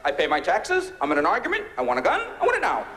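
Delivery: hint of a pushy American; mock gun buyer. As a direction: demand it like an entitled buyer. Impatient gun buyer character.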